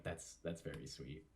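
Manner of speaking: soft, low, touched aside; touched murmur. Retold or imitated. Touched reaction to family names.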